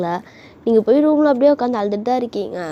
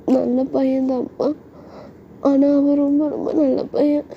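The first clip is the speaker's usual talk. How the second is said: put-on high, slow girlish voice; earnest daughter, pitched up; pleading daughter; 4 semitones higher than usual. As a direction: plead his case to dad, drawn out. Daughter earnestly defends boyfriend to father.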